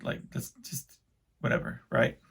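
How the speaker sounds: soft unvoiced aside; hushed mock shrug; whispered aside. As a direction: drop to a whisper.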